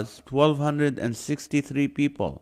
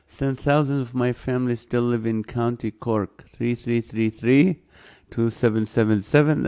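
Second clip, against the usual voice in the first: ordinary talk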